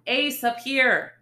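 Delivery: slow, high, loud call. Acted. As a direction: call him over from afar. Calling partner over.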